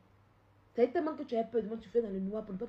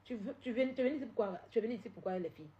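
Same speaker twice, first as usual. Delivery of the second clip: ordinary talk, nothing marked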